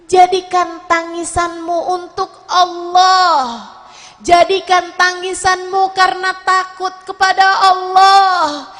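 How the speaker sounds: loud, ringing repeated calls with long held endings; full-force preaching. Real, not acted. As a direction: preach it out, each line swelling to Allah. (ceramah berapi-api dengan suara lantang)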